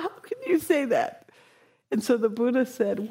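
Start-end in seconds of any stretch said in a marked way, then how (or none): 0.0-1.3 laughing through the question; laughing jeer. Retold or imitated. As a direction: jeer while still laughing. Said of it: "all this crew is like".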